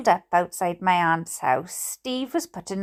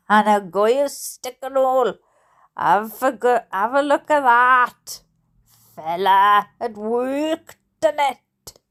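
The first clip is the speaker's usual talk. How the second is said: put-on Hampshire accent; 3 dB louder than usual. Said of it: "If I gotta speak Steve's language".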